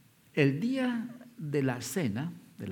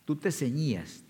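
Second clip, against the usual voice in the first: usual speaking voice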